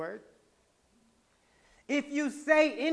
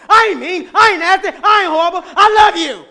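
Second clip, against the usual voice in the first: hammed-up shout, much higher and louder; mock yelling pop-off; 8 semitones higher, 21 dB louder than usual. Someone accused of being nasty, shouting back in a comic fit. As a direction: yell your denial comically.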